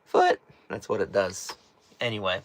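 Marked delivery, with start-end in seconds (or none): 0.0-0.4 "Foot." squeaked far higher, cracking; voice crack demo. Shown as a demonstration. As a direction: show the crack on "Foot." with a sudden high squeak. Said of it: "If you didn't hear that last one when I said foot".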